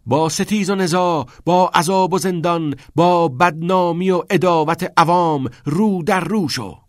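high, forceful reading of verse; loud declaiming. A narrator's reading. Meeks at the head of the line reads aloud, declaiming. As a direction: proclaim each phrase like a battle call.

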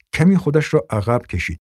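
ordinary delivery

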